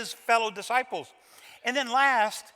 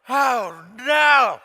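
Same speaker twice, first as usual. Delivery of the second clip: loud dismayed oh no; 2 semitones higher, 11 dB louder than usual. Real, not acted. Preacher to the congregation, loud dismay. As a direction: gasp it out in horror. Preacher reacts to Jesus' look.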